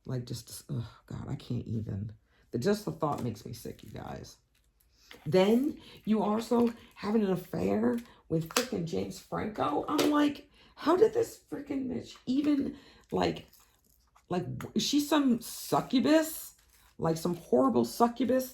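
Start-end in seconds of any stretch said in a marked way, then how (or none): 3.2-4.4 breathy whisper; whispered aside. Real, not acted. 9.9-18.5 high-pitched incredulous rant; outraged disbelief. Real, not acted.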